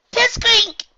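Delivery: very high, loud happy yell. A narrator's reading. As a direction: yell his name in joy. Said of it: "she yelled".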